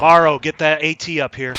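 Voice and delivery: loud, slow battlefield call; shouting orders. Real, not acted. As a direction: shout across the roof. Calling out in firefight.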